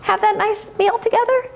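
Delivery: very high, innocent calf voice; little calf. Acted. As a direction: naive young calf making friends. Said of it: "And Bella says".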